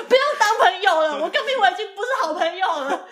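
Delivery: half-laughing imitation of an angry little kid; upset child. 小孩生气地回嘴老师.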